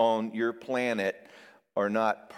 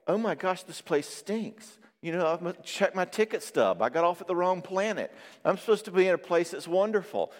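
higher, put-upon voice of a newborn; mock baby complaint; 7 semitones higher than usual. Babies complaining about the world, mock indignant and higher. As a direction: whine like a baby stuck on the wrong planet.

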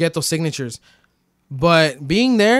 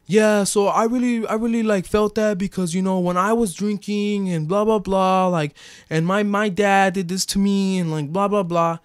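pitched-up earnest sharing voice, a bit hammed; mock AA sharing voice; 6 semitones higher than usual. A man mocking AA members at group share, earnest high voice. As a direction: play up a group-share confession, higher. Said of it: "Anybody wanna touch on that?".

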